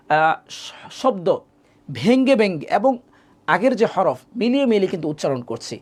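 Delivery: ordinary delivery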